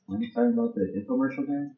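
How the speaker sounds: confused. Retold or imitated. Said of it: "I was like".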